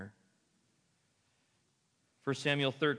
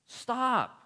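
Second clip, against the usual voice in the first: divine stop; 9 semitones higher, 3 dB louder than usual. Retold or imitated. God halting Samuel.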